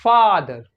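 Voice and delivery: फादर with a long, open, unrounded AH. Shown as a demonstration. Teacher giving a model word for American O, long open AH in फादर. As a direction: open the mouth on the first vowel of फादर. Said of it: "हल्का सा longer".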